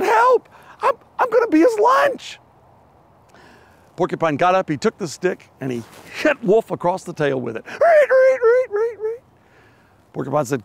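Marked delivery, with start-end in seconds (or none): 0.0-2.4 high, loud squeal of terror; high frightened rabbit screaming for help; panicked cry for help. Acted. Rabbit caught under wolf's paw. 7.7-9.2 high, loud yelp of pain; high yelps of a wolf hit on the tail; yelping wolf cry. Acted.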